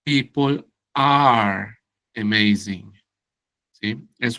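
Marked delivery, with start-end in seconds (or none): 0.0-2.9 people are amazing said very slowly; teacher modelling the correct sentence slowly; slow model. Shown as a demonstration. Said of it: "tendría que decir".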